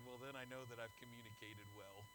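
a wry, hushed wisecrack; smart aleck quip. Retold or imitated. Husband joking back to his wife, dry and quiet. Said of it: "I was being a smart aleck".

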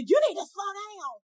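imitating a driver yelling out the window, high; mock yell. Quoting a stranger yelling at her from a car, mock yell. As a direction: toss off the stranger's shout in mock outrage. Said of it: "people yell out the window".

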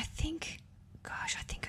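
hushed, trailing into a whisper. Real, not acted. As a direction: let the voice slip into a whisper. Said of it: "I'm whispering again".

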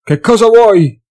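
high, loud and slow demand; wary shout. A narrator's reading. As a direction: bark it suspiciously through the door. Alchemist shouting through door. (grido sospettoso, alto e lento)

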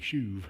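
"shuv" with a long oo and final v; pronunciation of shuv. Shown as a demonstration. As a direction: say "shuv" as "shoove". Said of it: "it's pronounced S H O O V E".

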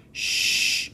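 voiceless hush; shushing. A narrator's reading. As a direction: hush them at once. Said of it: "shushed Lucas".

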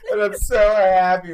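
real happy crying; choked up with joy; emotional. Real, not acted. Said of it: "I'm getting all emotional right now".

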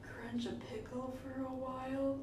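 soft whispered ASMR parody; mock ASMR. Host mocking an ASMR video for her cohost, hushed whisper. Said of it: "in a relaxation A S M R video".

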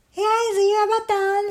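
high, quick Frog voice; helpful Frog. A narrator's reading. Frog finds a button in the mud, high-pitched. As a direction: pipe up in a high character voice.

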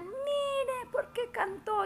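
high-pitched mock accusation, half laughing; mock complaint. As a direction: play the people blaming her singing for the storm. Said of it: "me decían".

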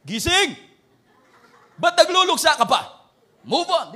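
high, loud wake-up yell; playful mock shout from above; shouting God. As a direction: bellow it to wake him up. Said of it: "para sakto na yung sigawan ako".

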